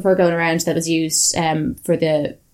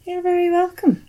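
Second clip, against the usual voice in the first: sing-song thanks; 12 semitones higher than usual. Real, not acted. Sing-song polite reply.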